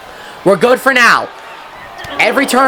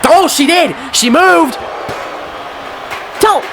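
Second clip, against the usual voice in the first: surprised cry; 9 semitones higher than usual. Real, not acted. Surprise comeback in match.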